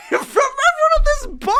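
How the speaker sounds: high, loud laughter over the words; laughing. Real, not acted. Telling viewers about the bar, laughing hard. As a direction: laugh the line out.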